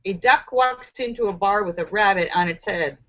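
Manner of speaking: normal talking voice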